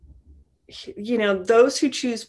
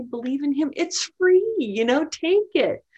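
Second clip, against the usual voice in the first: urgent pleading; 6 semitones higher, 4 dB louder than usual. Acted. Imagined plea to unbelievers.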